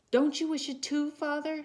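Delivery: high, loud appealing question; pleading mother. A narrator's reading. Wife appealing to her grieving husband, high and pleading. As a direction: urge her husband to share the wish. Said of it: "she said".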